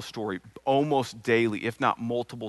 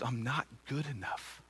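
tearful son; 10 dB quieter than usual. Retold or imitated. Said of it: "His eyes are filled with tears".

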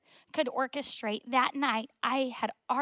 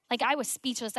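ordinary talk

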